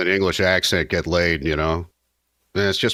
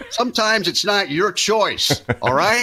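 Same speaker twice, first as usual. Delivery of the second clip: laughing high; 13 semitones higher, slower than usual. Real, not acted. Laughing comeback, pitch way up.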